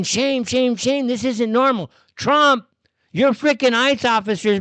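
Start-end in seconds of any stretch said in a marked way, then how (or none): none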